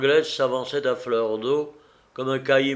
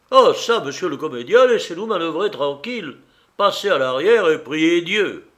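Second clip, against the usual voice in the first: loud, high-pitched dismissal; irritated captain's command; exasperated scolding; 4 semitones higher, 7 dB louder than usual. A narrator's reading. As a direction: order the nuisance out of the way. Captain orders the passenger away.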